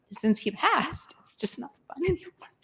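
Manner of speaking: a near-whisper, the grief held back; hushed grief. Real, not acted. Widow telling the chef her husband died, whispered grief. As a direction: let the loss drain the voice to breath.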